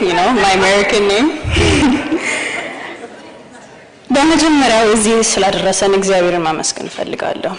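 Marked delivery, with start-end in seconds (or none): 1.3-3.1 a short, airy, nervous laugh; breathy nervous laugh at the podium. Real, not acted. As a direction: laugh it off lightly. Nervous laugh mid-speech.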